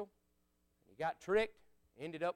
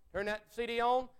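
ordinary delivery

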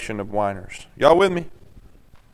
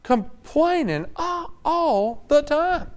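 mock complaining; 11 semitones higher, 4 dB quieter, much slower than usual. Mocking the complainers.

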